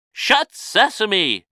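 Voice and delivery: high, loud, slow call; magic command. A narrator's reading. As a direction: shout the spell to seal it. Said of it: "he added".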